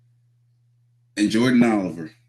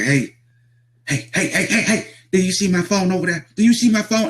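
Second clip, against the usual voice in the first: high, rapid-fire heys building higher and louder; imitated hyped-up agitation; 11 semitones higher, 4 dB louder than usual. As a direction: get more and more worked up, mimicking him. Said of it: "he's getting hyped up".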